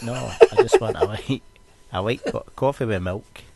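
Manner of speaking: huffing it out with a laugh; mock indignant retort. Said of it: "and it's like".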